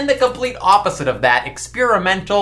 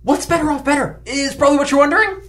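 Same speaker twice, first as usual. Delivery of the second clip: announcer voice; 8 semitones higher, faster than usual. Acted. Host's show-intro announcer voice.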